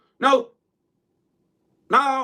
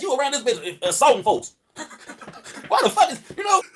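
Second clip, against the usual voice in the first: ordinary talk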